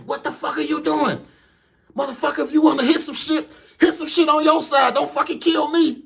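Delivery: high, loud furious mock yell; angry driver. Cut-off driver yelling at the truck, high furious yell. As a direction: scream at the trucker who cut you off.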